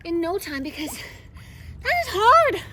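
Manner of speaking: panting between words after laps; breathless from swimming; out of breath. Real, not acted. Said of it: "I'm already gassed".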